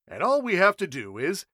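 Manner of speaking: high, loud, rushing excitement; can't wait to show it; eager. A narrator's reading. Trenchley eagerly opening case.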